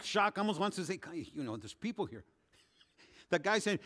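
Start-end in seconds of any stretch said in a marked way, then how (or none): 1.3-2.2 embarrassed aside. Retold or imitated. Pastor embarrassed, low aside.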